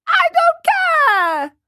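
put-on very high, loud childish yell; bratty tantrum at full pitch; defiant shout. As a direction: shriek it stubbornly, stretching the words. Sick puppet boy defies the fairy again.

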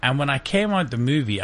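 ordinary talk

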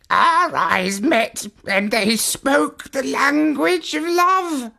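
high, earnest parrot voice, near tears; a smitten bird pleading to save his love, pitched high; high parrot voice, slowing at the vow; lovesick parrot. A narrator's reading. Parrot voice again.

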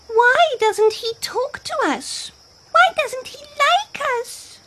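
very high squeaky animal voice; plaintive little animal. A narrator's reading. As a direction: ask plaintively in a tiny creature's high voice. Animals gossip about Hippo.

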